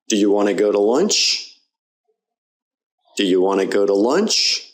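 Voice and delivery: "Do you want to go to lunch?" with "to" as tuh, rising on "lunch"; weak to. Shown as a demonstration. Teacher modelling for repetition, "to" as tuh. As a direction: reduce "want to" and "go to". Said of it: "listen and repeat along".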